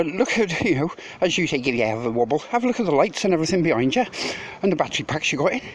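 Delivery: ordinary talk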